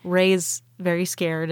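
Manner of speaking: normal talking voice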